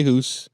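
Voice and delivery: "who's" as mock-Canadian "house", with an oo vowel; Canadian pronunciation. Shown as a demonstration. Reader showing how Canadians say house, "who's" with a long oo. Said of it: "I only say for house, they say who's".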